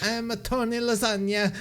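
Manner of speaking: hammed-up fake Italian accent; over-the-top Italian accent, laughing; bad Italian accent. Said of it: "a really bad Italian accent".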